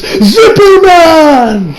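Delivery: long, high shouted attack name; drawn-out anime attack shout; battle cry. Acted. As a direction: bellow it like an anime special move. Acts out Bruno's attack call.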